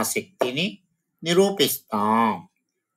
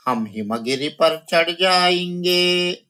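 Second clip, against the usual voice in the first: raised, sing-song recital of the line; poem recitation; 7 semitones higher than usual. Shown as a demonstration. Teacher reciting the poem line to her students, sing-song. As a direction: chant the verse to the class.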